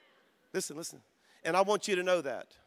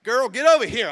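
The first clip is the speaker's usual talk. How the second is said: mock impatient call to the waitress; 4 semitones higher, 10 dB louder than usual. Impatient diner calling the waitress, mock loud. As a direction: bark for the waitress, louder. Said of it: "the most impatient, like".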